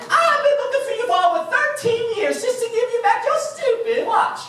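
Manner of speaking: high, hammed-up talking bear voice; Hagatha. Talking bear lectures the runner about fear, hammed-up high voice. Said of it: "Hagatha said to me".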